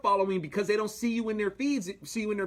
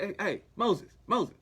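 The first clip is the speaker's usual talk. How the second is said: mock scolding; 5 semitones higher, 4 dB louder than usual. Joking retelling of God scolding Moses.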